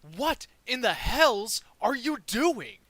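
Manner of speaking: incredulous bellow, every word hit hard; angry shout. Acted. Game master as wizard finding the dead Grick, shouting. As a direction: shout it high, loud and drawn out. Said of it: "And he just shouts".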